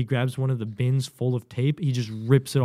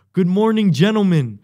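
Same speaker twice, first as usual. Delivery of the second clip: high, loud parade-ground call; loud formal greeting; 8 semitones higher, 11 dB louder than usual. Retold or imitated. Recruit addresses instructor at night, loud confident greeting. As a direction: belt out a confident announcement.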